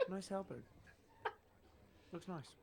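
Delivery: quiet aside. Acted. Player's character muttering in passing.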